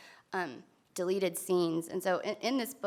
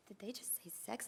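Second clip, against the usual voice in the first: much softer imitated aside; hushed disbelief; 15 dB quieter than usual. Onlookers react to the girls counting, hushed imitated question. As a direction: ask it under your breath, as onlookers would.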